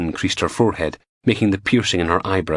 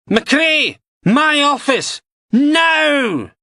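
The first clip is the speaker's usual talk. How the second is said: loud, much higher barked order; bellowing; 5 dB louder, much slower than usual. A narrator's reading. DI bellowing at McRae across the canteen. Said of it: "then bellowed".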